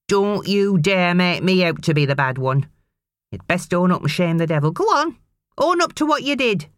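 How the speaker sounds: a hint of a threatening mother; warning mother. A narrator's reading. Mother warning her son to own up, threatening. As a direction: warn the boy to own up. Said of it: "she warned".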